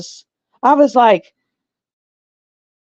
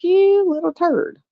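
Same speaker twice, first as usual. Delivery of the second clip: high, drawn-out, slow mock scold; 10 semitones higher, slower than usual. Owner to the breakaway dog, mock scolding. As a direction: stretch it out high at the naughty dog.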